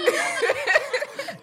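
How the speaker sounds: giggling high while tickled; being tickled. Real, not acted. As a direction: squeal with laughter, real. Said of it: "Don't tickle me".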